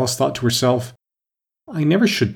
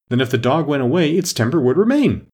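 high, mildly triumphant exclamation; 5 semitones higher, 4 dB louder than usual. A narrator's reading. Red Queen proving her answer to Alice, mildly triumphant. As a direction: land the point as a small victory. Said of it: "the Queen exclaimed triumphantly".

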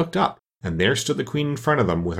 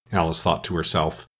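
usual speaking voice

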